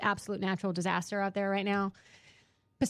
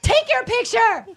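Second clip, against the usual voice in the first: drawn-out, high-pitched angry shout; loud, hammed-up fury; losing it; 9 dB louder, much slower than usual. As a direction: yell it in a retold blow-up. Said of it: "I lost it".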